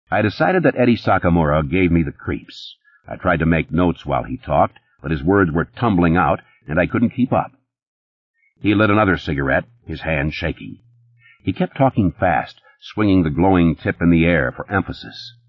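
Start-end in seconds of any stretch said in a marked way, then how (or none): none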